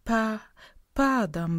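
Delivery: stuck on the first syllable; stammering clerk saying goodbye. A narrator's reading. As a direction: stammer before the word lands. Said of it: "bełkotał Wierzbicki".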